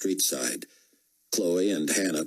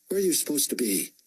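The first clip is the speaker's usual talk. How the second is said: fast, higher, demanding officer; stern officer; 9 semitones higher, faster than usual. A narrator's reading. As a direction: press the soldier quickly. Officer interrogates soldier.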